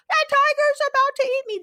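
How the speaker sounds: "That tiger's about" screamed high and loud; escalated repeat. Retold or imitated. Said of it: "They escalate".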